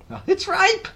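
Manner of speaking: high, loud delighted cry; Aunt Sponge crying out. A narrator's reading. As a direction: exclaim with greedy delight. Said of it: "she cried".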